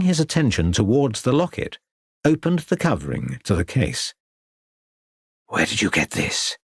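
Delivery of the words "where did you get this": breathy hushed question. Acted. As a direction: whisper it, startled. Artist sees locket, alarmed.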